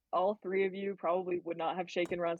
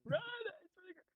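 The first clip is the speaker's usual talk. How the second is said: much higher, exasperated squeak; high pleading. Acted. Player character begging Ron, in a much higher voice.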